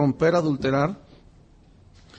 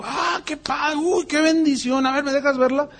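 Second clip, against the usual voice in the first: gushing, higher voice; mock gushing; 7 semitones higher than usual. Fawning over the missionary's new truck, mock gushing. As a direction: lay the flattery on thick.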